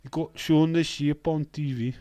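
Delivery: second go at Welsh, drifting towards Indian; bad Welsh accent. Streamer retrying his shocked Welsh viewer, bad Welsh accent. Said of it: "I can't do a Welsh accent".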